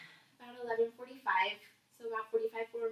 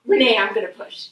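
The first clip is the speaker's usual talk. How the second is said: loud, urgent call; retold urgent announcement; 14 dB louder than usual. Labouring mother warns her midwife, retold loud and urgent. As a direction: call it out loudly, re-enacting the moment.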